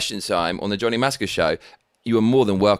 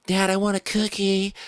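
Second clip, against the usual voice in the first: put-on American kid; 8 semitones higher than usual. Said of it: "So you say cookie".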